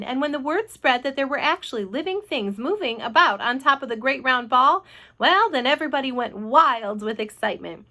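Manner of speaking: ordinary delivery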